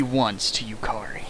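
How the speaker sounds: whisper. Real, not acted. Disgusted aside at the end.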